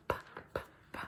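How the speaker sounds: whispered P sound, p, p, repeated softly; sounding out words. Shown as a demonstration. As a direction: breathe out the letter sound p, p. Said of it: "I need to sound out".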